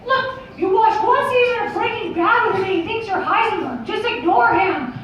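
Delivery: high cartoon falsetto, confiding; high-pitched cartoon mouse aside; Mickey Mouse. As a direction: explain it in a squeaky mouse voice. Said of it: "Mickey comes over to me and he's just like".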